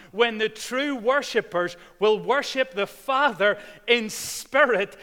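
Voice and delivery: loud, high full-force preaching. Acted. Preacher retelling Jesus to the congregation, loud and high. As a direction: proclaim it to the whole church.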